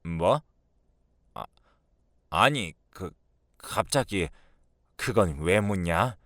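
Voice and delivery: hesitant, halting reply; a touch of flustered defensiveness; evasive mother-in-law. Acted. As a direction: stall when caught out.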